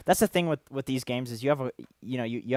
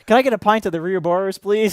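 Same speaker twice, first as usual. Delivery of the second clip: higher, louder playful order; customer ordering; 7 semitones higher, 12 dB louder than usual. Pretend. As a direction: order a pint with a grin. Ordering pint, laughing.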